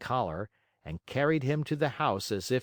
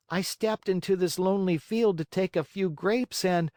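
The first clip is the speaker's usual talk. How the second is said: put-on high puppet-boy voice; Pinocchio explaining himself; 9 semitones higher, 4 dB louder than usual. A narrator's reading. Caught marionette excuses himself to the glowworm, high-voiced. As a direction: explain it in a high boyish pitch.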